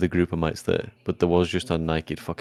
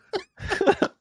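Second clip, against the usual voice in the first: shrill burst of laughter; laughing at the dick joke, high squeal. Real, not acted.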